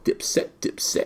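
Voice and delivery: slow whispery Dipset, Dipset, drawn out. Retold or imitated. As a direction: imitate how "Dipset" is dragged out slowly. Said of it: "but he says it all".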